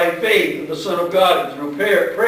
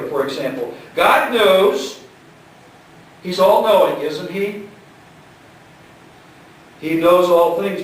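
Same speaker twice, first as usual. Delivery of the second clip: usual speaking voice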